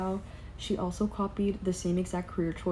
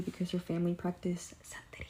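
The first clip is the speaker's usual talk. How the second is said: whispered aside; 6 dB quieter than usual. Real, not acted. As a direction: drop to a whisper for the taboo word. Whispering a taboo topic.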